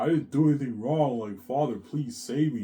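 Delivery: mock desperate appeal; tearful pleading. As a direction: act out begging your father for help. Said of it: "I'm crying to my father".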